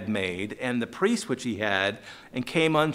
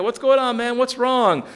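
raised, louder concerned neighbors; higher and louder, a friendly worried neighbor; voicing the neighbors; 9 semitones higher, 5 dB louder than usual. Preacher voicing neighbors asking Micah what's wrong, raised concern. Neighbors voiced in Bible retelling.

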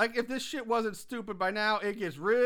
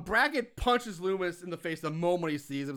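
usual speaking voice

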